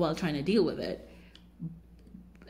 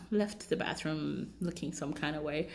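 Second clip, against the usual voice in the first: usual speaking voice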